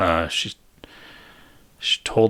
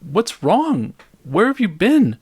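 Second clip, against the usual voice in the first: high, louder, anxious questioning; alarmed questions; 11 semitones higher, 4 dB louder, slower than usual. Acted. Karen pressing her ex for answers, high and urgent. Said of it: "she's like".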